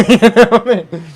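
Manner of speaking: high, loud laugh through the words. Real, not acted. Streamer laughing while ranting about get on, laughing. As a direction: laugh out the question.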